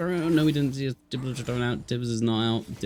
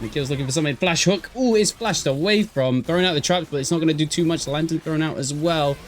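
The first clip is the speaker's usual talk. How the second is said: fast, raised caster call; excited play-by-play; 5 semitones higher, 5 dB louder than usual. Acted. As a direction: call the fight live, building. Live play-by-play of a fight.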